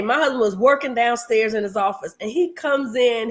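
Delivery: ordinary delivery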